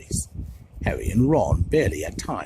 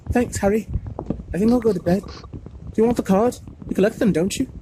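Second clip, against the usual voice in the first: put-on timid boy; 10 semitones higher, 5 dB louder than usual. A narrator's reading. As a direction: thank a friend shyly. Neville, weak smile.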